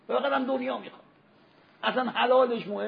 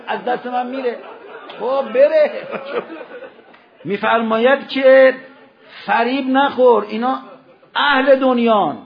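loud, high, emphatic pulpit delivery; preacher in full cry, easing at the end; forceful preaching; 6 semitones higher, 9 dB louder than usual. Real, not acted. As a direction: hammer the warning out phrase by phrase.

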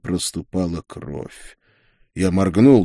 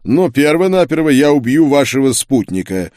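raised, forceful duke's threat; cold threat; 7 semitones higher, 6 dB louder than usual. A narrator's reading. Duke threatens to kill her companion, cold. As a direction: threaten coldly, louder and higher. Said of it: "холодно ответил герцог".

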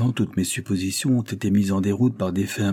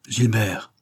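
s'écrie. A narrator's reading. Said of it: "s'écria Henri qui se leva".

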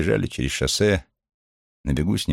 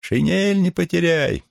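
high, slow call into the distance; calling from afar; 13 semitones higher, 3 dB louder, slower than usual. A narrator's reading. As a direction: shout it after him as she leaves. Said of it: "Донеслось только".

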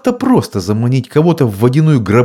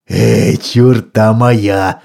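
vampire bat voice; much slower than usual. A narrator's reading. Vampire bat Friday speaks.

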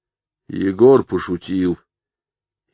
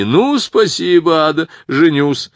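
hammed-up delight; 9 semitones higher, 6 dB louder than usual. Roma teasing after card.

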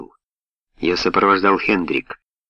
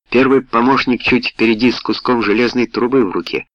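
usual speaking voice

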